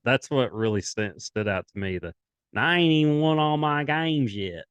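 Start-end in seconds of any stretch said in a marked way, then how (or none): 2.5-4.6 put-on Southern accent. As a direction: lay on a heavy Southern accent, pitched up. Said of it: "in his Southern accent".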